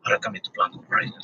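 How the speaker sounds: fast, whispered tagline like an ad's closing read; hushed rapid ad-read voice; commercial voice-over guy. Retold or imitated. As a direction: breathe it out quick like the voice at the end of a commercial. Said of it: "talk like the fucking dude at the end of a commercial".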